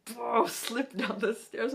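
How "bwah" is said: louder, higher "bwah" of falling; mock slipping yelp. Retelling the fall, loud mock yelp. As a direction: yelp again as you slide.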